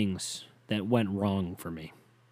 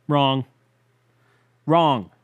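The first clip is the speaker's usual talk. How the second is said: loud, punched single words; raised, forceful buzzer-like denial; emphatic contradiction; 3 semitones higher, 7 dB louder than usual. Real, not acted. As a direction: hit each word hard, then stop. Answers own question emphatically.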